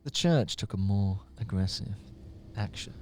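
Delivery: quiet, low reading; softly intoned, low-pitched recital; too quiet. Acted. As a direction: read it low, barely projecting.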